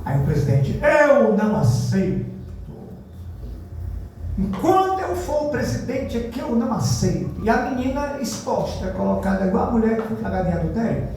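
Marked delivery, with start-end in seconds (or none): 0.8-7.4 stubborn refusal. Retold or imitated. Church president refusing the girl.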